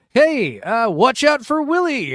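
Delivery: high, loud, slow voice; hammed-up calling voice; character voice. Voicing a player's call.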